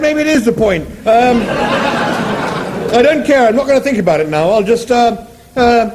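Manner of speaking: high appalled Englishman; Arthur appalled. Acted. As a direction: squeal with disgust.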